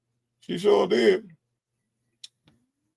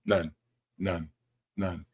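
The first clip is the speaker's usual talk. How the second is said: normal talking voice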